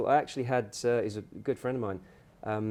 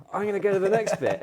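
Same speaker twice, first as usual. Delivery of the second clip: high, fast burst of glee; retelling his own giddy excitement; excited; 10 semitones higher, 8 dB louder, faster than usual. Retold or imitated. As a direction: bubble over with the urge to keep going. Retold thought, getting carried away.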